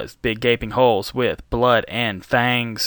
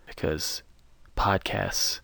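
hushed ominous whisper; 5 semitones lower, 9 dB quieter than usual. Pretend. Hushed mock-ominous aside.

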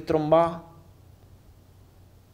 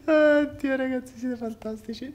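laughing; 11 semitones higher than usual. Real, not acted. Cracking up at the chat.

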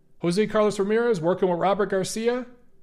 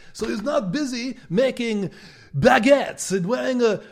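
higher hammed-up French accent; mock French accent; 5 semitones higher than usual. Mocking the French challenger, hammed-up French accent. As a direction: lay on a jokey French accent. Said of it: "my French accent".

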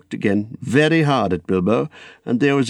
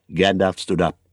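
usual speaking voice

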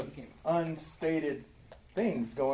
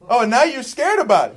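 loud, high-pitched taunt; mocking; 9 semitones higher, 12 dB louder than usual. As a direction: throw his fear back at him, loudly. Mock confronting Cain over his fear.

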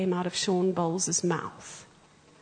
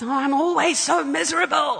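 high, louder whiny self-pity; mock complaining; 6 semitones higher, 5 dB louder than usual. Preacher mocking people who complain about their lives, whiny. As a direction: whine it like a moaner. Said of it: "We can complain about our lives".

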